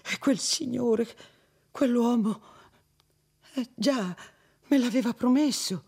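trembling with horror. Acted. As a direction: barely get the words out for terror. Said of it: "labbra contratte e tremanti di spavento e di orrore".

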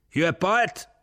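high, loud query; shouted question. A narrator's reading. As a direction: holler it across the engine noise. Officer shouts question back.

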